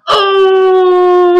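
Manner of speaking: long, high, loud imitated crowd hoot; really loud crowd "oh". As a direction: belt out a drawn-out mocking "oh" like a whole crowd. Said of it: "really loud".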